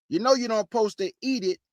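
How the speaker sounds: ordinary talk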